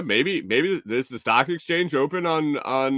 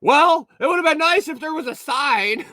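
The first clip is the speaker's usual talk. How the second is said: put-on high, louder grumbling old lady; huffy old lady; 5 dB louder than usual. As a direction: complain like a put-out older woman. Old lady complains to clerk.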